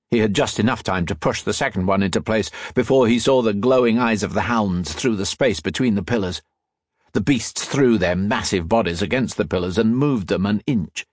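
ordinary delivery

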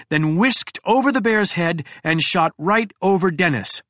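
ordinary delivery, nothing marked

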